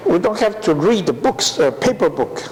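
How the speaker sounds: normal talking voice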